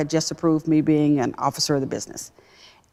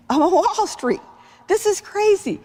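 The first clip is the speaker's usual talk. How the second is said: overjoyed disbelief. Retold or imitated. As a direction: burst out in joyful disbelief. Crying with joy on Wall Street.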